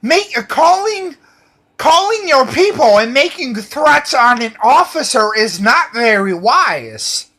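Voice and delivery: high, loud hammed-up scolding officer; shrill-pitched mock cop rant; mock officer yelling. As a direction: yell at him like an exasperated cop. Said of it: "she's like".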